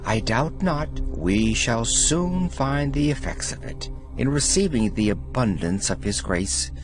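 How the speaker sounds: ordinary delivery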